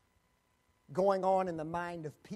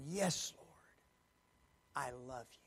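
hushed, whispered confession of love; whispered vow; 6 semitones lower, 8 dB quieter than usual. Acted. Peter whispering his love to the Lord. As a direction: breathe it as a secret vow.

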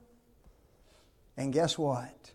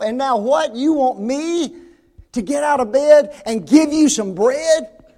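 high, building to loud disbelief; exasperated protest; 5 semitones higher, 5 dB louder than usual. Acted. Woken father to neighbor wanting bread, exasperated protest. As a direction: woken parent indignantly listing grievances.

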